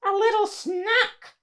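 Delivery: high, sneering monster voice; sly monster. Acted. Monster friend eyeing Mo as food, high and sly. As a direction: relish it like a hungry creature. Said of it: "one of his friends said".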